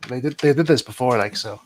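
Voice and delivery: usual speaking voice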